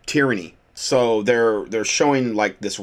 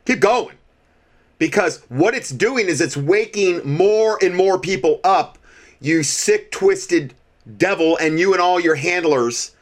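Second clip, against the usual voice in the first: heated, raised-pitch defiant rant; paranoid late night rant; 6 semitones higher than usual. Real, not acted. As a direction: shout your defiance at the devil, pitched high. Host raging at Biden over mandates.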